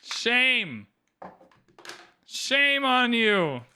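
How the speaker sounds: slow, drawn-out scolding; mock shaming. Streamer shaming a game opponent, slow mock scolding. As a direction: stretch out the shaming word by word.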